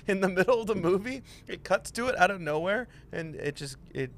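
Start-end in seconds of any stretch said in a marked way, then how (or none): none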